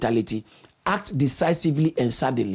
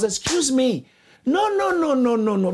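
high insistent no, no, no; emphatic refusal; 8 semitones higher than usual. Real, not acted. Preacher refusing a congregant's excuse, high and emphatic. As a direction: cut the excuse off sharply, pitch jumping high.

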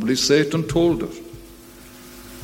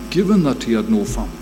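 ordinary delivery

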